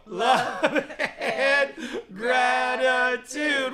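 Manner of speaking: soaring very high, loud shout-chant with a laugh; like an eagle. Shown as a demonstration. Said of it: "Like an eagle".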